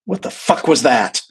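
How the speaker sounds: loud, stunned mock exclamation; shocked outburst. Client to therapist after the exorcism, shocked outburst. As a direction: burst out in acted disbelief.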